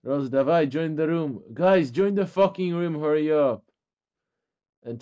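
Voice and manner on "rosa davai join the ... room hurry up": loud, raised-pitch urging; impatient calling out. Real, not acted. Leader hurrying players into the game room, impatient calling out. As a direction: holler impatiently to round people up.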